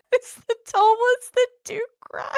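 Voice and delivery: high-pitched, slow, laughing words; cracking up while saying it; laughing hard. Real, not acted. Repeats joke, cracking up.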